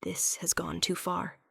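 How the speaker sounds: soft breathy near-whisper; king warning Thorn quietly, almost whispering. Acted. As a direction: hush it, grave and final. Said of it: "the king says quietly".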